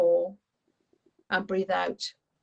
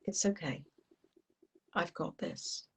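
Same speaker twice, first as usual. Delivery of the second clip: very soft, slightly lowered, calming voice; reassuring; 3 semitones lower, 11 dB quieter than usual. Acted. Child calming panicking Larry the lizard, soft reassurance. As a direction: soothe gently, with pauses between phrases. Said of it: "he's panicking. And I go".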